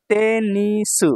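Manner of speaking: তেনিসু slow, each syllable held. Shown as a demonstration. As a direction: stretch তেনিসু into three beats. Teacher sounds out new word.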